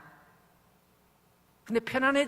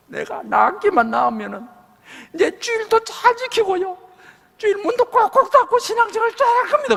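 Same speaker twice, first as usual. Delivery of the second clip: pleading sick deacon; 14 semitones higher, 7 dB louder than usual. Retold or imitated.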